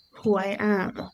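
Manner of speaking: usual speaking voice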